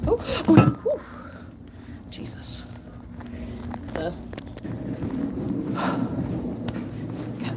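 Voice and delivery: winded, gasping after stairs; puffing hard after a climb; out of breath. Real, not acted. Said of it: "these stairs got me out of breath".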